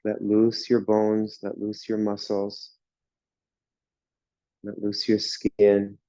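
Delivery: ordinary talk, nothing marked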